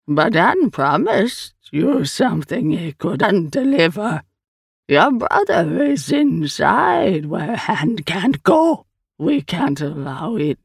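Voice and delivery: slow, stretched alien speech; strange creature, refusing. Acted. The Eye tells Sal of her brother, slow creature voice. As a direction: refuse slowly as an otherworldly being.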